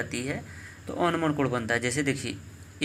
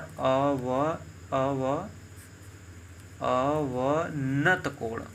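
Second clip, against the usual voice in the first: "अवनत कोण" drawn out very slowly in pieces; slow, stretched syllables on "अवनत कोण"; slow dictation; much slower than usual. Shown as a demonstration. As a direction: sound out "अवनत कोण" part by part, with pauses. Teacher sounds out the new term.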